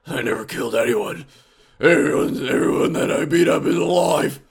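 loud, put-on superhero voice; hammed-up vigilante insisting on his record; Batman. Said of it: "and he'll tell you so".

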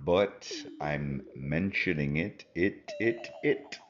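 deep slow promo voice; ad read voice. Acted.

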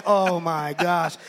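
loud, slow, drawn-out exclamation; mock dismay. Wife with hand on her head, dismayed. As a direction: stretch it out, overwhelmed.

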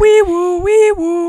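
high, loud wee woo wail; siren imitation. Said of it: "like, sirens".

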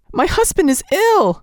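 high, tearful cry of despair; sobbing. A narrator's reading. Nellie sobbing to the doctor, high and tearful. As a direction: break out into a high tearful plea. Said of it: "she sobbed out".

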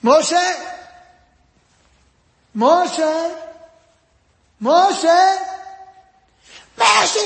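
calls rising from plain to a high loud yell; a mother calling her son, building to a shout. Retold or imitated. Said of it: "llega la mamá y le dice".

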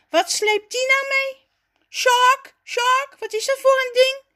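very high, loud, amazed yelling; astonished shout. A narrator's reading. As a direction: shout in disbelief from afar. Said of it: "schreeuwt hij verbaasd". (verbaasd schreeuwen, hoog en luid)

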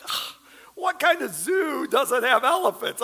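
high-pitched, exaggerated disbelief; mock indignation. Visitor reacting to zookeepers about missing elephants, mock indignation. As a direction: act out outraged astonishment with a big gasp of protest.

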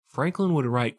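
ordinary talk

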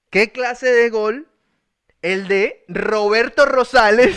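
"Roberto Rosales" slow with strongly rolled R's; slow model phrase with exaggerated R's; emphatic R. Shown as a demonstration. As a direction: draw out each word, trilling the R in "Roberto Rosales". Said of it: "le cuesta pronunciar la R".

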